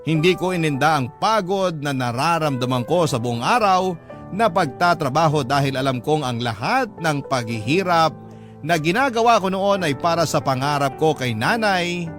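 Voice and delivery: normal talking voice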